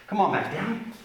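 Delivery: ordinary talk, nothing marked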